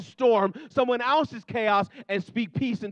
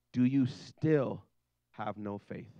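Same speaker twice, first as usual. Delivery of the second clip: slow searching question; 9 semitones lower, 5 dB quieter, much slower than usual. Retold or imitated.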